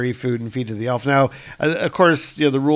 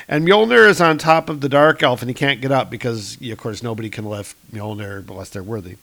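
ordinary delivery

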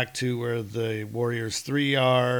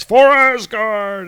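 loud, high, slow shout; battle cry; 9 semitones higher, 13 dB louder, slower than usual. Acted. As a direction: roar it to the heavens. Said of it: "And Thor shouts".

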